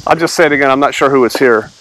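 ordinary delivery

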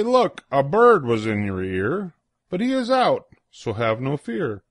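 usual speaking voice

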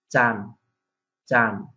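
চান said without the d of চাঁদ; চান, nasal ending, no final d; Barisal dialect word. Shown as a demonstration. Teacher models চান for moon in Barisal. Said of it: "এটা বরিশালে হবে".

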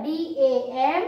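slow, each letter set apart; slow letter spelling. Shown as a demonstration. Teacher spelling for children, slow. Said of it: "spelling છે ડી એ એમ".

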